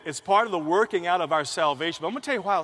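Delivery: ordinary delivery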